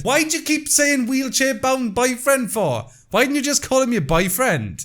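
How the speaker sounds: high, slow, incredulous complaint; exasperated question. Real, not acted. Scolding the poster over her wording, high and incredulous. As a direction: throw the question at her, fed up.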